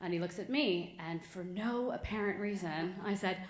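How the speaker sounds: ordinary delivery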